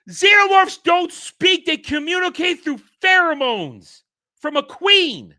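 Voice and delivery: can't believe what he just saw; incredulous outburst. Real, not acted. Reviewer outraged that she speaks xenomorph, shouting in disbelief.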